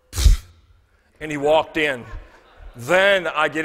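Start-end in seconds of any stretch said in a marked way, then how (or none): 0.1-0.4 loud scornful pfft; dismissive pfft. Retold or imitated. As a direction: blow out a contemptuous pfft. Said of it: "His exact words were".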